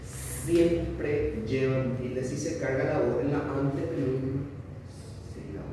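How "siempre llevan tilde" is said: "Siempre llevan tilde" said very slowly, stressing siempre; slow emphatic rule. Shown as a demonstration. Teacher to students, repeating the accent rule slowly. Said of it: "no hay una excepción".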